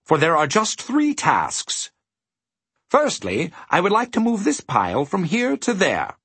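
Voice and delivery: high, pleasant gentleman's voice; happy. A narrator's reading. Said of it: "he said happily".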